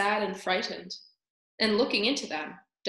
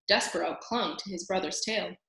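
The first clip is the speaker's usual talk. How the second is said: usual speaking voice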